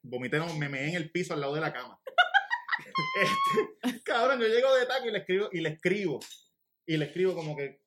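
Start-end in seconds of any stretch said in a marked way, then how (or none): 2.1-3.8 a big high burst of laughter. Real, not acted. Friends laughing at his story.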